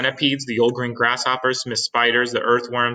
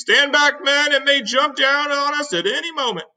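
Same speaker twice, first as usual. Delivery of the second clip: warning shout; 5 dB louder than usual. Acted. Chief warns his men.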